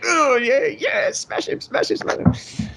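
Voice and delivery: put-on very high-pitched, gleeful kid cheering on a fight; high boyish cheering, breaking into airy voiceless chanting; excited little boy. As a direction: squeal it like an overexcited little boy. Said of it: "the little boy in me is like".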